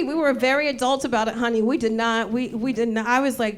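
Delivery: ordinary talk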